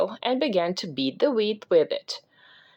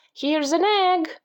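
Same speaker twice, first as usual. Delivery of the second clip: put-on high, drawn-out boyish call; storybook shout, pitched way up; excited shout; 10 semitones higher, slower than usual. A narrator's reading. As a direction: call it out high and slow, as Jack. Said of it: "shouted Jack".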